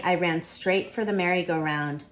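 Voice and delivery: usual speaking voice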